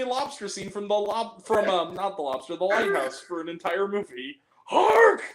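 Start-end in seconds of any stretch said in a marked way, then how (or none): 4.7-5.2 mock old-sailor bellow, much higher and louder; a hammed-up theatrical cry; Dafoe impression. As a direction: belt it out like a mad lighthouse keeper. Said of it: "Willem Dafoe yelling at the top of his lungs".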